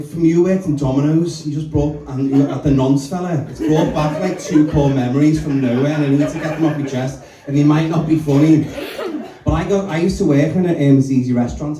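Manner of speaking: normal talking voice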